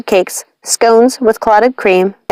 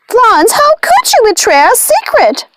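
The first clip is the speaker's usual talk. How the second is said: high, outraged witch voice. A narrator's reading. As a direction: accuse the cat of betrayal.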